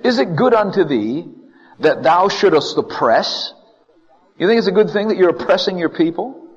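acted indignant challenge; accusing. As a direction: hurl the question up at God. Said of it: "He's accusing God".

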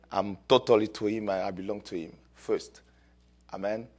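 ordinary talk

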